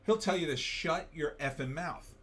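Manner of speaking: ordinary delivery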